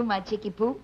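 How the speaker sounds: normal talking voice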